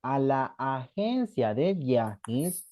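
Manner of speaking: very slow, vowels stretched; teacher giving a sentence to translate; drawn-out dictation. Shown as a demonstration. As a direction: stretch each word for the class. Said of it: "¿cómo dirían eso?".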